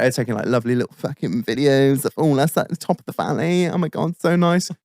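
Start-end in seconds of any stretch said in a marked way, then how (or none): none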